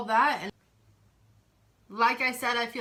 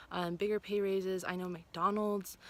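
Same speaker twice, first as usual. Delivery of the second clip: usual speaking voice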